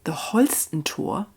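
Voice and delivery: soft, hushed bedtime storytelling voice; calm, intimate storyteller reading to children; story narration. A narrator's reading. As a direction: read it gently like a cozy fairy tale. Reads fairy tale to viewers.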